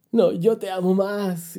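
slow, drawn-out lovers' comeback in full voice; loving insistence. Acted. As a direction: insist back, stretching the words out. Believer playfully insists back to God. (insistencia cariñosa, alargada y a plena voz)

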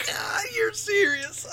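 high, slow, helpless disbelief; incredulous laughter. Real, not acted. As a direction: wail it through real laughter. Cracking up in disbelief at confusion.

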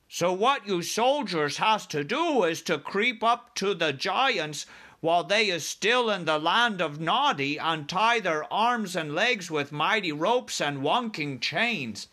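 high, lilting giant voice; BFG instructing. Acted. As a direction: lay out the plan as the giant.

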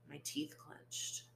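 whispered through clenched teeth; a barely voiced, clenched line; tense whisper. A narrator's reading. Narrator's anger at laughing detective.

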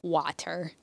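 water with open "wah" and crisp t; standard pronunciation. Shown as a demonstration. Host tries the standard way, water as "WAH-ter". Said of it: "Who says water?".

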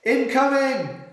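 high-pitched warning yell. A narrator's reading. A soldier warns of incoming fire, high urgent yell. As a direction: yell it out across a battlefield. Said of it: "I heard a voice yell".

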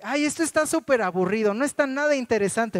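mock whiny kid complaining loudly; a daughter grumbling to her dad on the cave tour; bored little girl. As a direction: pout it out like a bored child. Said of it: "mi hija".

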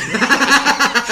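carcajada alta y fuerte. Real, not acted. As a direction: estalla de risa. Ríe de la anécdota. (carcajada fuerte y aguda)

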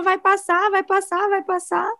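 high, airy parroting of everyone's comfort line; lightly mimicked cheerful consolers; mock reassurance. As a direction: echo the empty reassurance everyone repeats, lightly mocking. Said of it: "Todo mundo fala".

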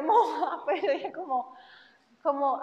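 contándolo con una risa que se le escapa; reírse de su propia anécdota al decirlo; entre risas. Real, not acted. Se ríe contando su propia anécdota. (riéndose al hablar)

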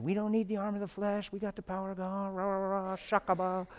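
sing-song mock holy-roller rant; wrong attitude. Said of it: "go in there with a wrong attitude".

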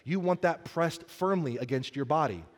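ordinary talk

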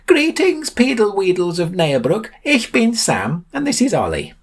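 very high, sing-song ceremonial hail; high theatrical introduction; grand greeting. A narrator's reading. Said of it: "Sam greeted the Peedle Weedles".

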